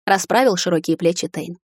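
ordinary delivery

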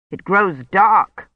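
slow, understated anger; angry. A narrator's reading. Priest to Kivrin who blocks him, restrained anger. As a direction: say it slowly with contained anger. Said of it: "he said angrily".

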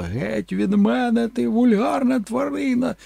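high, slow shouted insult; mock outraged actress. Actress scratching the creature and shouting, high slow insult. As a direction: hurl it at the brute. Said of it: "кричала".

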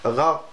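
غاء, throaty gh standing in for R; غاء with a guttural gh instead of a rolled R; R turned into ghayn. Shown as a demonstration. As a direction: say غاء as a faulty راء, gh for R. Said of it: "أو ينطقها غين".

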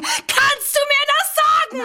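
sehr hoch, laut, gedehnt; hysterischer Aufschrei. Acted. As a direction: die Frage kreischen. Hysterischer Ausbruch. (gedehnter hoher Schrei)

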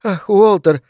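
high, pitiful moan; wounded wife's wail; plaintive moan. A narrator's reading. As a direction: moan it as a hurt plea. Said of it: "жалобно простонала Этель".

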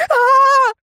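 high, loud despairing howl; anguished scream. A narrator's reading. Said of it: "Наконец заорал он". (отчаянный вопль)

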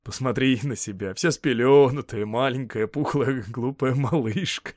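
teasing through laughter, ending in a laugh; laughing mockery. A narrator's reading. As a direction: tease the child, chuckling as the words draw out. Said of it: "Он снова засмеялся". (дразнит сквозь смех)

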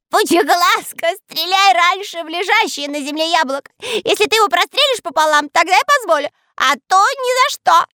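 very high, loud panicked squeal; terrified shriek. A narrator's reading. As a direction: scream in a voice not his own. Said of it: "не своим голосом закричал". (кричит не своим голосом)

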